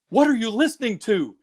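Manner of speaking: high-pitched imitation of a shocked mom; mom's indignant question. Mom barging in on her teenager's music, indignant and high. Acted mom confronting teen son.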